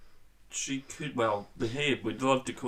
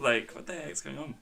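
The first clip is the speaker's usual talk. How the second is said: normal talking voice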